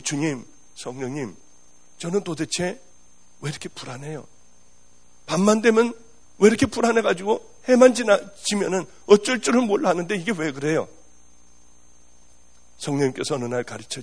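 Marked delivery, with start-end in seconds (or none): 0.0-4.3 softly asking the Lord, much softer; a quiet, troubled plea in prayer; soft, worried prayer to the Spirit; hushed prayer. Retold or imitated. Pastor praying to the Holy Spirit. 5.3-10.9 pressing the Lord for an answer, uneasy; a touch of distressed pleading; anxious plea. Retold or imitated. Anxious plea continues in prayer.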